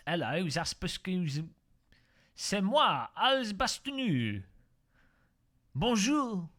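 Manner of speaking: hammed-up French patter with drawn-out words; terrible French accent. Streamer clowning for viewers about baguettes, terrible French accent. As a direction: put on a bad, exaggerated French accent. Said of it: "that's enough of the terrible French accent".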